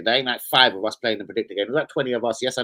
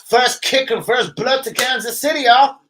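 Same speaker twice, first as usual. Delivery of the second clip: high, loud hype announcement; big, high-pitched opening call; hyped shout; 11 semitones higher, 6 dB louder than usual. Real, not acted. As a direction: shout it out high to kick off the show. Streamer opens with a hyped announcement.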